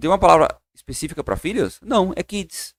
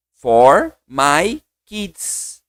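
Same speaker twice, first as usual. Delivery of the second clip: put-on For my kids, very slowly; slow model phrase; 4 dB louder, much slower than usual. Shown as a demonstration. Teacher slowly models a phrase for students. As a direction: stretch each word out.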